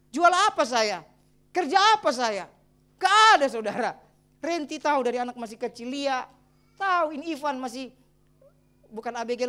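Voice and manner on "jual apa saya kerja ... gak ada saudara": louder rhetorical questions, punched; loud preaching. Real, not acted. Pastor challenging the congregation, preaching loudly. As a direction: fire the questions at the church.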